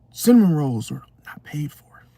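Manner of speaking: hushed aside whisper. Acted. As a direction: whisper it like a secret. Aside about stolen rolls.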